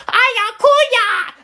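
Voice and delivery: put-on high, squeaky kid squeal; mock squealing kids. Kids slapping each other at Target, high squealing mimic. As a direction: squeal it like bratty little kids.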